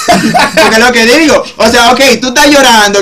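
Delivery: half-laughing while making the point, slowly. Real, not acted. Amused friend mocking a guy who cries yet won't stop talking, laughing.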